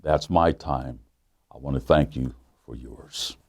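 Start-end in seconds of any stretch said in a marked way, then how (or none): none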